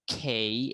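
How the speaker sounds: drawn-out খেই, k released with a puff; aspirated British খেই. Shown as a demonstration. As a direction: model খেই with an "ey-ee" glide. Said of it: "এরকম হবে pronounce".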